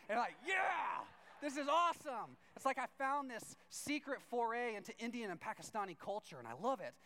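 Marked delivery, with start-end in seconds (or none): 0.5-2.4 high, loud, exultant cheer; over-the-top burst of delight; hammed-up elated shout. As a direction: shout it like a thrilled fan. Retold delight at being included.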